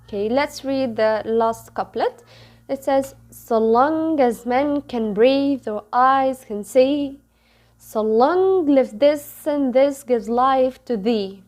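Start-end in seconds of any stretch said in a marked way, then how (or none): 3.3-11.4 measured iambic beat, stress on LONG, MEN, BREATHE, EYES, SEE; teacher demonstrating the meter, every second syllable stressed from "so LONG" onward; iambic pentameter reading. Shown as a demonstration. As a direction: read the couplet with SO light and LONG drawn out. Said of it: "this is the way to read something which is written in iambic".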